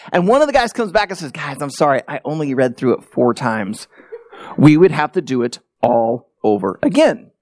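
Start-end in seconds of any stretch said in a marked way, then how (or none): 1.3-3.9 lightly acted sheepish apology, lower voice; apologetic. Group member confessing to the guys, apologetic. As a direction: own up to the group, mildly sorry.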